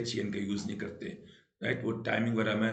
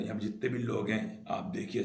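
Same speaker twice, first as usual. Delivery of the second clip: ordinary delivery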